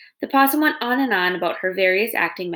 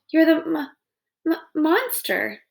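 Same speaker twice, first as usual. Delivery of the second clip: higher, stammering, drawn-out fear; frightened stammer; 7 semitones higher, slower than usual. Acted. Possum realising who Roz is, scared stammer. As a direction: stutter in fright over the last word.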